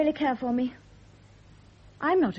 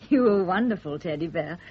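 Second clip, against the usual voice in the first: normal talking voice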